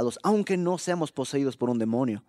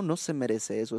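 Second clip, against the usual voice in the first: ordinary delivery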